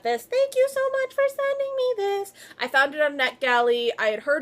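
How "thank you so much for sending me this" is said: high, squeaky thank-you; gushing thanks. Acted. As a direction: squeal your gratitude at the publisher.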